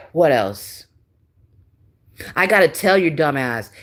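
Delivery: ordinary talk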